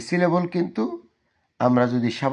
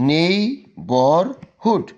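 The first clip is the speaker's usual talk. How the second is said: "Neighbourhood" slow and higher, nei... bar... hud; three separate, stretched beats on "neighbourhood"; broken into syllables; 4 semitones higher, much slower than usual. Shown as a demonstration. Teacher showing students a three-part word, "neighbourhood" syllable by syllable. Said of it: "এটা কিন্তু তিনবারে হয়েছে".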